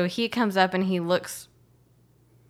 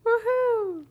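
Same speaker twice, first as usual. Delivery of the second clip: high, long woohoo; cheer. Real, not acted. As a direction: whoop it in celebration. Cheering at podcast end.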